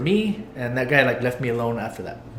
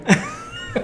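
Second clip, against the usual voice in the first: short, very high breathy giggle; squeaky laugh. Real, not acted. Retelling Tim's jealousy, squeaky giggle.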